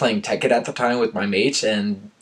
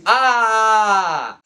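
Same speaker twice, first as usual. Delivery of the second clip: high, sudden cry of alarm; mock scream; 11 semitones higher, 4 dB louder than usual. Narrator fakes a scream at his own story. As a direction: belt out a pretend scream. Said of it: "I am screaming".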